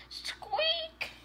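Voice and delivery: tiny, high, soft squeaky bunny; very high, quiet bunny squeaking; chocolate bunny squeaks. Pretend. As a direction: squeak softly like a little toy rabbit. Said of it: "jumping around and saying squeak".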